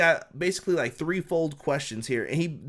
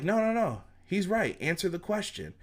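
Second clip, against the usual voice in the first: mock Jericho; 5 semitones higher, 3 dB quieter than usual. Jericho siding with Bischoff.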